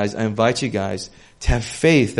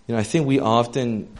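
ordinary talk

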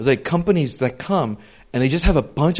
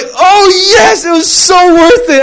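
thrilled; 14 semitones higher, 10 dB louder, slower than usual. Retold or imitated. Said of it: "And I was like".

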